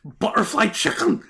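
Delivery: loud, high, excited Scouse answer; passionate shout. Retold or imitated. Liverpool man answering the interviewer, loud excited shout. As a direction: belt it out with passion. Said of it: "so much passion in how they talk".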